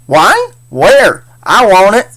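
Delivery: high, loud eager southern yelp; eager southern farmer. Acted. Farmer James McPoor jumps at the mention of wine, eager southern. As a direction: perk up like a thirsty farmer.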